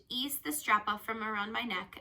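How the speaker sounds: plain reading voice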